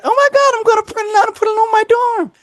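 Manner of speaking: put-on high squealing girlfriend; excited girlfriend voice. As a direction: gush like a thrilled college girl.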